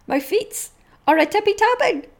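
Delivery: high, loud excited squeal; excited dog. Acted. Dog in the tweet hearing its owner come home, squealing. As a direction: squeal it like a happy pup. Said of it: "read you some more".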